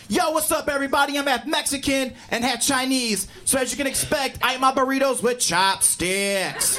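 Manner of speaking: high, pumped-up MC opener; hyped stage comic. Retold or imitated. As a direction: open big like a hype comic. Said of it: "Next guy ... did the same thing".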